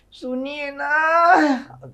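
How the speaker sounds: high, very slow drawn-out plea; whining call. Acted. Wife calls husband from her bed, drawn-out whine. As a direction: stretch the plea out.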